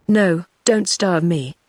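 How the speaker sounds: ordinary delivery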